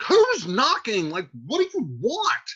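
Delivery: high, exasperated mock shout; shouting at door. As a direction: demand it loudly through the door. Retold friar shouts at knocker.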